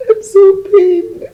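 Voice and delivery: high, loud, slow wail of grief; crying out. Acted. Mourning friend breaks down.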